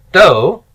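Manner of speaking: "do" punched hard as the stressed ending; "do" pushed out emphatically; accent on final syllable. Shown as a demonstration. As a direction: hit "do" with a strong accent. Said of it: "The accent is on the final syllable".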